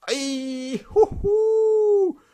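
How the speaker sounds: high, loud whoop of delight; sudden high yelp of joy; excited whoop. Real, not acted. Excited by a big sticker.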